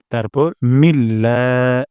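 মিল্লা drawn out slowly, the ল্লা held long; stretched vowel. Shown as a demonstration. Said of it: "এক আলিফ টান দিব". (এক আলিফ টান দিয়ে মিল্লা)

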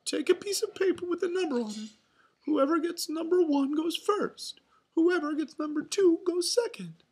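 high, loud falsetto announcement; Mickey Mouse announcing. A narrator's reading. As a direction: take charge as a cartoon mouse. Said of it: "Mickey announced".

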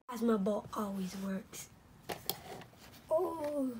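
ordinary delivery

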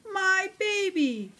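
high, relieved mother mouse voice. A narrator's reading. Mother Mouse finding her lost baby, high and relieved. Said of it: "says Mother Mouse".

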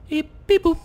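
very high beep-boop noises; mock computer beeps. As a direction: play at being a computer. Pretends to work a computer.

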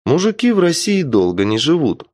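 usual speaking voice

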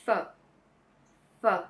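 f breathed out on its own; f sound alone. Shown as a demonstration. Tutor isolates the f sound for learners. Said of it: "first the consonant".

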